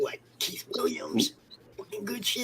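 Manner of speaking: unvoiced whispered aside; whispering. Real, not acted. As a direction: breathe it out, no voice.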